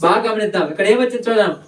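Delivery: ordinary talk, nothing marked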